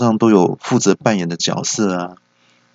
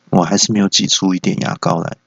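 ordinary talk

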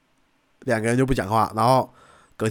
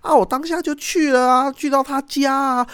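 put-on high, girlish voice, much higher; imitating the friend; 13 semitones higher than usual. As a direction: play the flustered friend telling it. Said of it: "他朋友就说". (模仿朋友高声讲述)

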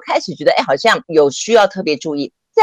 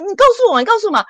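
much higher, coaxing insistence; eager insistence; 13 semitones higher, much faster than usual. Retold or imitated. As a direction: press her eagerly to tell, pitched high. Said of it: "他就很热情". (热情追问)

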